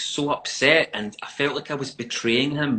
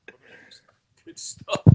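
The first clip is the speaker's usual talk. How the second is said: whisper; 8 semitones higher, 9 dB quieter than usual. Real, not acted. Whispered secret.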